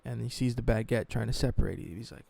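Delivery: normal talking voice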